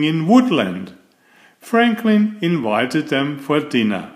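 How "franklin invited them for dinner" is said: plain reading voice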